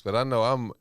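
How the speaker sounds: normal talking voice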